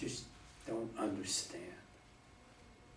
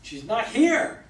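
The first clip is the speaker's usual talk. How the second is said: loud, higher, slow cry of grief; anguished outburst; 8 semitones higher, 12 dB louder than usual. Acted. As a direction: hurl it back, tearful and furious. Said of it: "Rearing back, eyes wet".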